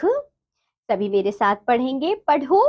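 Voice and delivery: usual speaking voice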